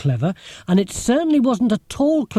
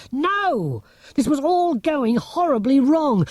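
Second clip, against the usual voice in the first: 1 panicked; 10 semitones higher, slower than usual. A narrator's reading. Sudden panic.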